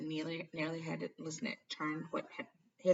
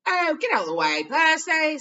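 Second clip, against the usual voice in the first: loud teasing; 12 semitones higher, 16 dB louder than usual. A narrator's reading. Fred teasing Percy loudly.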